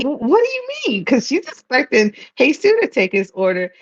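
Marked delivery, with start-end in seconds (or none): 0.0-1.0 sudden high pitch of confusion; confused. Retold or imitated. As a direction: caught off guard by the customer, a flustered high what do you mean. Ponytail girl confused by his arrival.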